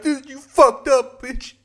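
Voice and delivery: high, slow hammed-up cry; mock panicked guy. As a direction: play a guy fleeing in fright. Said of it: "He just runs off like".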